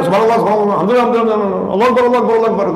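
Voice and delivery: gabbled dhikr rattled off with words swallowed; rushed careless dhikr. Shown as a demonstration. Preacher mocks the congregation's hurried dhikr after prayer, gabbling. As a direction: mumble it fast like a chore. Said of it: "Jangan asal".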